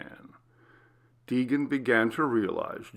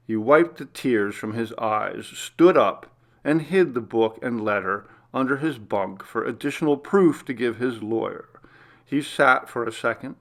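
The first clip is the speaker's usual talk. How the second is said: ordinary delivery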